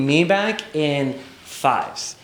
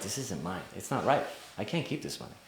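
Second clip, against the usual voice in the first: hushed, much softer voice; inner thought; 4 semitones lower, 12 dB quieter than usual. Retold or imitated. Son silently deciding the money isn't his, hushed inner thought. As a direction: think it aloud, very quietly.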